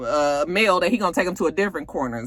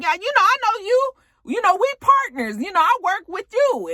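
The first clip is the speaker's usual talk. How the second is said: pitched up high, overeager and chummy; high eager Mel voice; 13 semitones higher, 4 dB louder than usual. Retold or imitated. Mel eagerly tells Kane they're partners, in a high overeager voice. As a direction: play the clueless rich kid sucking up.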